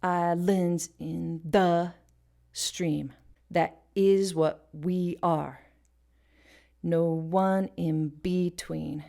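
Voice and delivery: slow, with "lands", "the", "is", "we", "one", "be" hit; stress on offbeat syllables. Shown as a demonstration. Teacher showing where the beat falls, slow misplaced stresses. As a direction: chop it slowly, leaning on "lands", "the", "is", "we". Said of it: "that typically do not get emphasis".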